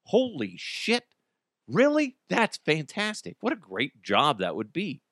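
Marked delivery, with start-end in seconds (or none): 0.1-3.4 impressed surprise. Pretend. Impressed date reaction.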